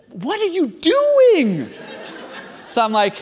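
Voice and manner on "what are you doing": high, drawn-out appalled cry; appalled pastor. Retold or imitated. Pastor side scolding his aggressive side, appalled. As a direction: stretch it out high, aghast at yourself. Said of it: "and [name] went".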